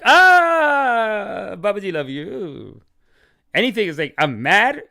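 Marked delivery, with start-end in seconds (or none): 0.0-2.8 shocked then loving guru. Pretend. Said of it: "I'm like".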